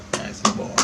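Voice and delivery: soft whispered praise; hushed repeat of the praise from Mom. Retold or imitated. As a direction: whisper it like a proud mother. Voicing Mom's praise, hushed.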